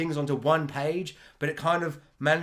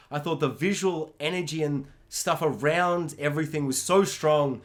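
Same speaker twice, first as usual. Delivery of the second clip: usual speaking voice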